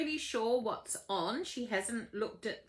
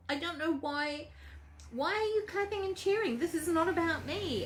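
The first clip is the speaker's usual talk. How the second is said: high-pitched, baffled questioning; bewildered protest; 8 semitones higher than usual. Acted. Bewildered Phyllis addresses crowd.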